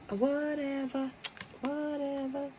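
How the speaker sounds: high, slow sing-song whatever; mock airy dismissal; drawn-out whatever. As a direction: stretch each whatever up high and lazy.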